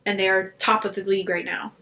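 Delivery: usual speaking voice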